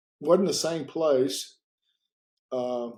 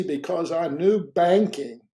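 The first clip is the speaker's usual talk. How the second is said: ordinary talk, nothing marked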